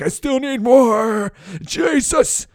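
high, slow, breathless mock woman; insatiable woman. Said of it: "she's still running out afterwards like".

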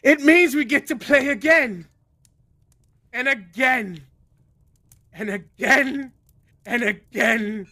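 grin through it, drawing out each repeat; singsong stage host, repeats gradually winding down; smiling showman. Acted. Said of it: "he smiles, he says".

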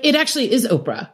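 quick, high-pitched mock insistence; insistent retort. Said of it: "I'm like".

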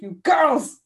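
angry dad. Retold or imitated. Father scolding his laughing daughters, a mock angry yell. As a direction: snap at the giggling girls. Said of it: "then he'd be like".

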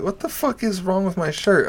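high-pitched, incredulous comeback; mock indignation. Comic retelling reply to shirt insult, indignant.

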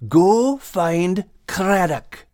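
slow, high command; Max ordering. A narrator's reading. Max sending the gulls, slow high command. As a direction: send them off slowly.

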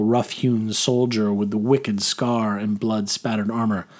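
ordinary delivery, nothing marked

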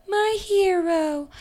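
high, slow swooning sigh; drawn-out dreamy damsel; mock swoon.

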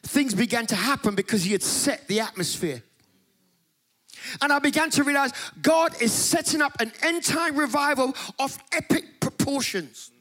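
preaching. Acted. Preacher stirring up the church about the Holy Spirit, fervent preaching. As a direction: preach it at full force to the congregation. Preacher builds revival message.

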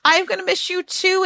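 high, pleading mock-worried goodbye; anxious clingy parent. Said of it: "you're showing your stress".

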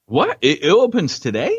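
high, still reeling question; can't believe it, ask again; stunned disbelief. Real, not acted. Disbelief the film opens today.

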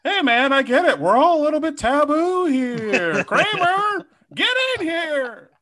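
high, loud sitcom-comedian impression; hammed-up stand-up comic voice, stretched line ends; Jerry Seinfeld. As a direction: pitch it way up, exasperated observational comic. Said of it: "Jerry Seinfeld. Jerry Springer being like".

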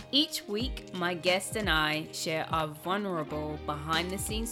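smooth host intro read over music; podcast intro. Acted. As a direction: read it like a polished show opener.

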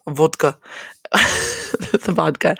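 slow, emphasized vodka with a laugh; slightly Russian-sounding vodka; vodka with accented ending. Real, not acted. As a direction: lean on the end of vodka. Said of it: "put like, an emphasis on the".

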